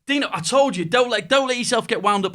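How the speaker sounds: a half-time telling-off from the boss, voiced up high; exasperated manager. Manager scolding player at half time.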